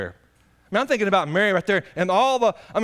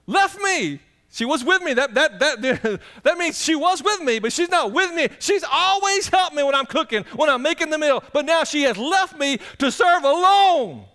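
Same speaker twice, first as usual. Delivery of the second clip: Martha's grievance; 8 semitones higher than usual. Acted. Martha complaining her sister left her, high whine. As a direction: act out the hurt sister, half laughing.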